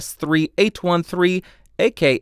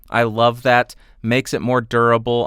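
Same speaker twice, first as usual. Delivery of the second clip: ordinary talk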